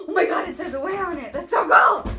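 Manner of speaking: fast, loud, excited gush; excited squeal. Real, not acted. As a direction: squeal with delight. Unboxing new luggage, thrilled.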